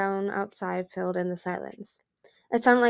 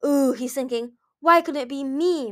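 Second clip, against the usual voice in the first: high, louder teasing glee; gleeful; 6 semitones higher, 6 dB louder than usual. A narrator's reading. Mind Reader broadcasting a friend's jealous thoughts, gleeful. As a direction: announce it with relish, pitched up. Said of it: "Mind Reader announced gleefully".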